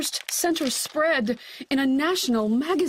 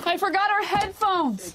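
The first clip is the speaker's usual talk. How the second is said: higher exclaimed realisation; flustered; 6 semitones higher than usual. Acted. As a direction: catch the mistake with panic. Mother frets while packing.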